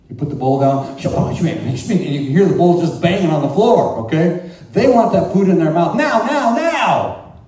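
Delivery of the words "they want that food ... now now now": louder and higher, rising to a frantic now; like a dog desperate for food; urgent building demand; greedy urgency. Retold or imitated.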